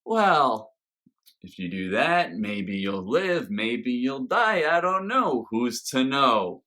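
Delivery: sing-song shrug of a sage, swinging up and down; Bendu. Retold or imitated. Reviewer imitating the Bendu's take on fate, noncommittal sing-song. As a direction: weigh both outcomes noncommittally, stretching the words. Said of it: "how the Bendu plays this".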